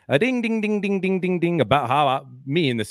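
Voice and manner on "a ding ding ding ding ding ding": high, rapid-fire dinging; imitated alarm bell. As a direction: ring out like a bell, dropping in pitch. Imitates an alert bell ringing.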